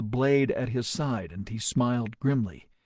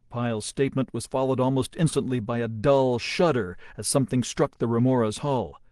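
ordinary delivery

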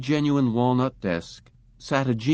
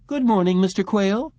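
bright, higher receptionist greeting; pleasant receptionist; 10 semitones higher, 4 dB louder than usual. A narrator's reading. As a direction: greet him sweetly from the counter. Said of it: "said pleasantly".

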